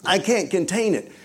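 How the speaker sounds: high, bubbling excitement; retold enthusiasm. Preacher bursting beside his cool mentor, uncontainable enthusiasm. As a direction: gush as if unable to hold it in. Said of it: "so full of enthusiasm".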